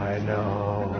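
very hushed, low, drawn out; reply barely above a murmur, low. Shown as a demonstration. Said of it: "should it get louder or softer?".

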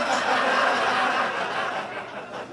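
high, loud burst of laughter; real laugh. Real, not acted. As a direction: laugh hard at your own joke. Teller laughs at his punchline.

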